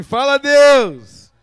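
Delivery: very loud, drawn-out shout to God; loud call. Shown as a demonstration. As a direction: lead the crowd in a slow shout. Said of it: "Diga assim".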